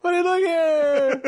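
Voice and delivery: very high, slow mock whine; Seinfeld. Co-host carrying on the comedian impression, high and whiny. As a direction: stretch it out in comic outrage. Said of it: "how would Seinfeld do it?".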